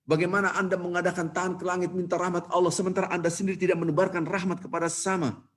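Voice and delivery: retold preacher's stirring exhortation; impassioned preacher. Khotib urging pilgrims to look at themselves, impassioned. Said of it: "ini yang menyebabkan orang nangis".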